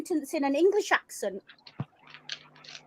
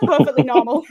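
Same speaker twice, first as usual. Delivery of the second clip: laughing; 6 dB louder than usual. Real, not acted. Joking about mixing family accents.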